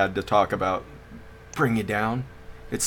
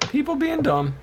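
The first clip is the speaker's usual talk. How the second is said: mocking voice; 3 dB quieter than usual. Echoes own line mockingly.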